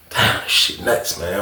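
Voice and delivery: slow, hushed disbelief; stunned muttering. Retold or imitated. Reading the note in his cell, stunned and hushed. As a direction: let it out slowly under the breath.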